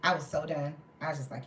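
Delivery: normal talking voice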